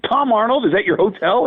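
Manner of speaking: high, rising disbelief; can't believe Tom Arnold is there; incredulous. Retold or imitated.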